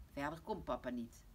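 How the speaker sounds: ordinary delivery, nothing marked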